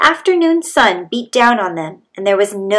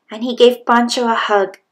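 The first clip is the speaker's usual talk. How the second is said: ordinary delivery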